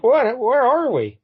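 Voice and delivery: high, loud startled questions; mock bewilderment. Turtles see the flooded city, mock startled bewilderment. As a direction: act out stunned confusion, pitched right up. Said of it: "And they're like".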